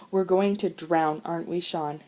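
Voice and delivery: ordinary delivery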